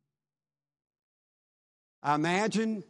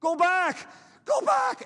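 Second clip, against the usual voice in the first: desperate scream, much higher and louder; screamed warning; 5 dB louder, slower than usual. A narrator's reading. Drowning teen screams to warn his friend, desperate shriek. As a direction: shriek it like your life depends on it. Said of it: "and he screamed".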